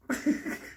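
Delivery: high-pitched wheezing laugh. Real, not acted. Storyteller cracks up at everybody's tension, high laugh. Teller cracks up at tense moment.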